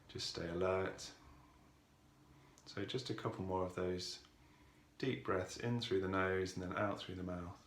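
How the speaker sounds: calm, softened voice with long pauses; guided meditation. Real, not acted. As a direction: ease listeners into stillness, unhurried and even.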